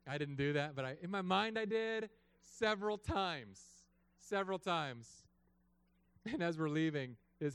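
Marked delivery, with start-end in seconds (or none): 1.6-5.3 savoring. Real, not acted. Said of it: "relishing repetition".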